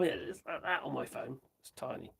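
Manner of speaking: ordinary delivery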